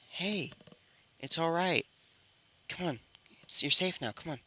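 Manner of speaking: gentle comforting. Acted. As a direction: softly coax a frightened child out. Comforting a scared little girl.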